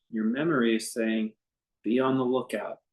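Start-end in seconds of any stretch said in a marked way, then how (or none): none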